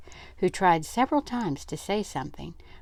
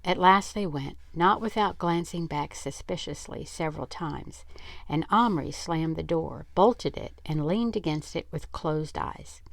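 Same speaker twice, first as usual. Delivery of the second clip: plain reading voice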